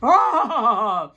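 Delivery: forced laugh falling on one breath; demonstrated exhaled laugh. Shown as a demonstration. Teacher demonstrates breathe and laugh, falling forced laughter. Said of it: "Breathe and laugh".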